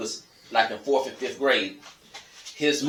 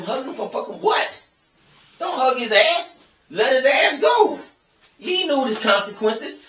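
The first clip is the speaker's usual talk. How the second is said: furious rant; 12 semitones higher than usual. Real, not acted. Furious rant about stabbing son.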